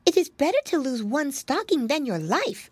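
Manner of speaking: high creature voice; little mouse. A narrator's reading. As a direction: reason as a small storybook mouse. Mouse reasons with piglet.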